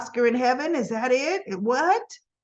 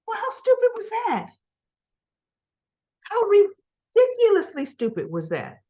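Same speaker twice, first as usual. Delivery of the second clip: exasperated; 10 semitones higher than usual. Real, not acted. Her own exasperated thought.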